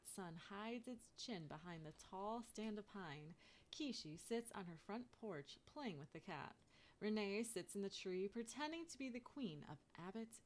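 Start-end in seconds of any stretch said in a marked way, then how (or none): none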